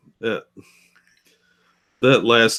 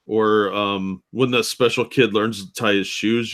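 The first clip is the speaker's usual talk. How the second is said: usual speaking voice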